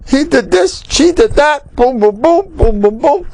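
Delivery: high-pitched singsong parody of accusations; mock bickering. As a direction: mimic a squabble in a high voice. Mimics couples trading accusations.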